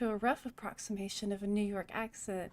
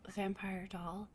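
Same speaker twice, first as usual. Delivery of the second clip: softly whispered nickname; whispered name; 4 semitones lower, 9 dB quieter, slower than usual. Acted. Cheng whispering the runner's nickname, slowly. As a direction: breathe the name, softer and lower.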